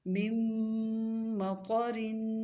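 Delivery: مم مطرن very slow, م held with a long nasal hum, ط heavy; tajweed recitation demo. Shown as a demonstration. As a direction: recite مم مطرن dragging the ghunna. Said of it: "طا کو خوب موٹا پڑھنا ہے". (غنہ اور موٹے طا کے ساتھ آہستہ تلاوت)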